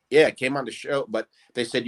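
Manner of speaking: usual speaking voice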